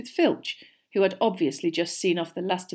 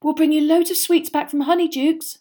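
sympathetic friend; 9 semitones higher, 7 dB louder than usual. A narrator's reading. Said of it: "looking desperately sorry for him".

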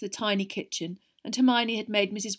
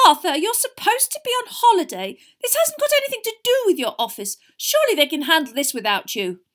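high, loud upset plea; plead, upset and worried; upset wife; 11 semitones higher, 10 dB louder than usual. A narrator's reading. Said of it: "looked most upset".

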